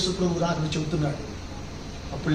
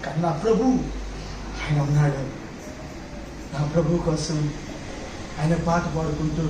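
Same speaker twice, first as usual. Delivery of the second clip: humble surrender; 3 semitones lower, 4 dB quieter than usual. Acted. Voicing Zacchaeus' surrender.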